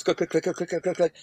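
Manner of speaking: rapid-fire door-click noises, kept soft; imitated clicking. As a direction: mimic a row of cell doors clicking open. Imitating the prison doors unlocking.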